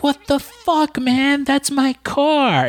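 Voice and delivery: high mock outburst over the car; mimicking Janet. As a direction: squeal it out like an outraged starlet. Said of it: "She's like".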